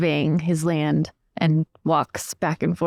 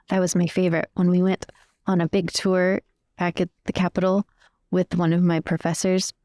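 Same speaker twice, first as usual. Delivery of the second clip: normal talking voice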